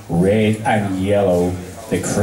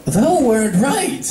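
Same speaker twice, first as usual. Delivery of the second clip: high, slow archaic regal voice; Luna, stately and pleased; Princess Luna; 11 semitones higher, slower than usual. Acted. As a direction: speak like an old-fashioned royal. Luna speaks to Pinkie.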